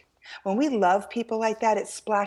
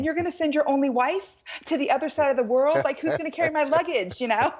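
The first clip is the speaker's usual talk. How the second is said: mock indignant; 7 semitones higher than usual. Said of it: "And I'm thinking".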